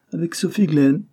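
amazed repeat. A narrator's reading. Repeating the name of the supposed bride, high and astonished. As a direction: throw the name back in disbelief.

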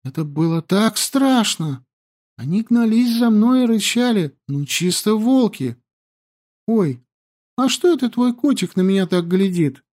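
put-on high, lively young girl; girl's voice. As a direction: chatter high and breathless. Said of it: "Yandi voiced by narrator".

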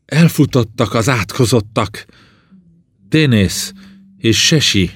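high, loud, anguished shout; crying out in pain. A narrator's reading. Burned princess naming those who abandoned her, shouting in anguish. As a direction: hurl the accusation out to the world. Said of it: "a világba kiáltotta fájdalmát".

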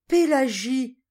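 loud, high exclamation of sudden realisation; startled shout, pitched well up; astonished cry. A narrator's reading. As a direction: cry out the name as it dawns on you. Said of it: "s'écria monsieur Dormer".

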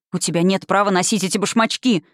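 usual speaking voice

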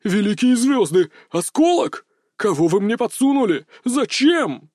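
high, hammed-up horror; horrified. A narrator's reading. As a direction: wail in alarm and outrage. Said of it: "с ужасом подскочил". (с театральным ужасом)

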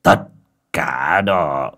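low, drawn-out icy verdict; understated menace, stretched out; cold, steely. A narrator's reading. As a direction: say it coldly, with a touch of steel. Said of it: "giọng lạnh lẽo đầy đanh thép".

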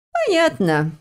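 high-pitched sighed acknowledgement; sighing. A narrator's reading. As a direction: sigh it out, sliding down from high. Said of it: "вздохнула ящерица". (со вздохом)

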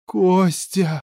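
high-pitched cry of alarm; screamed call. A narrator's reading. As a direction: cry the name out high in desperation. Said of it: "заорала Ната".

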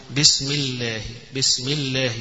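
الله with a clearly doubled lam. Shown as a demonstration. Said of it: "لابد من إظهار التشديد". (إظهار شدة اللام في الله)

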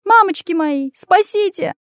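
very high panicked squeal; squealing in terror. A narrator's reading. Anya falls from the second floor, squealing. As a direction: squeal for help as you fall. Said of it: "взвизгнула Аня и полетела вниз".

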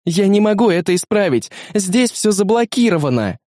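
high-pitched helpless protest; distressed exclamation. Acted. Rada finding the interface blocked, high distressed cry. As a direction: cry out that you are stuck.

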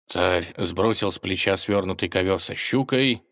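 plain reading voice

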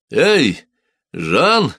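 the farmer calls to his servant; calling out. A narrator's reading. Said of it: "крикнул он".

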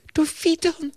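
very high, distraught teacher voice; acted high-pitched despair; desperate cry. A narrator's reading. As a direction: cry it out helplessly, high in the head. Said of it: "riep ze radeloos".

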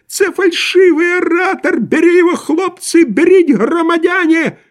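very high, loud answering cry; thin tenor shout. A narrator's reading. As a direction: yell back in a thin tenor. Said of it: "второй тонкий тенор". (крик тонким тенором)

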